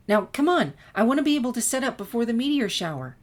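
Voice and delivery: excited Twilight rushing her friend; eager. A narrator's reading. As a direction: hurry her along, all enthusiasm. Twilight eagerly pulls Sunset along.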